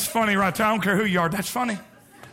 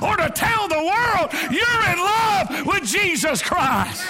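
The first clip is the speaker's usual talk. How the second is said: preaching at full force; 7 semitones higher than usual. Acted. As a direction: preach it out, building up.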